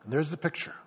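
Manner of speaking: ordinary talk, nothing marked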